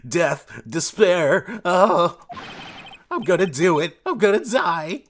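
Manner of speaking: high, loud anguished wailing; despairing wail. Acted. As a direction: wail it like all is lost.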